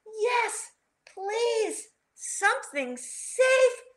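high, drawn-out plea; begging. A narrator's reading. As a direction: plead for safety in a storybook voice. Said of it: "begged Rocky".

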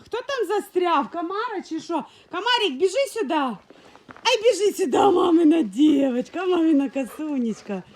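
high, loud coaxing call; doting call to a pet; pet talk. Real, not acted. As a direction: coax the little dog to run over. Calling the dogs to come.

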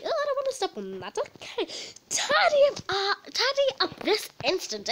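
high, loud bossy posh voice; posh demanding. Pretend. Pretend posh pupil demanding the grass be tidied. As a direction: order it tidied this instant. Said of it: "one that's a bit posh".

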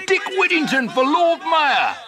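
very high, loud rallying shout; crowd cheer. Acted. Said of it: "cried the people".

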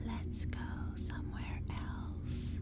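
slow, dry, choked whisper; harsh rasping whisper, stretched out slowly; hoarse crackle. A narrator's reading. Pumpkinhead creature luring the kids away, in a hoarse crackling whisper. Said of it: "His voice came out in a hoarse crackle".

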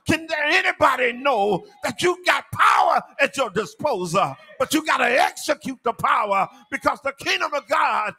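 high, driving sermon delivery, phrases run together; full-force preaching. Real, not acted. Preacher telling church they need kingdom power, full-force preaching. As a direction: build to an urgent pulpit shout.

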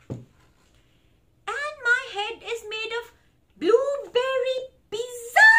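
high, very slow, swelling to loud; silly declaration. Acted. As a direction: proclaim the nonsense grandly. Book makes reader declare nonsense.

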